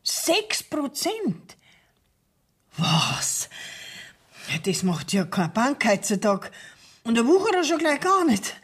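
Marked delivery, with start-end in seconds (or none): 0.0-3.5 slow, high, whispered disbelief; incredulous. Acted. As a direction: breathe it out in shocked disbelief. Indignant at six percent offer.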